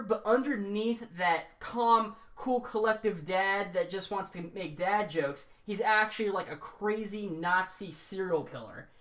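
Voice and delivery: normal talking voice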